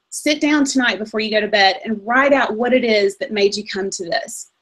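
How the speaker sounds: ordinary talk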